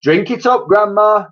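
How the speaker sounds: high, loud with a big grin; grinning. A narrator's reading. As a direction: urge her on gleefully. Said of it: "grinning hugely".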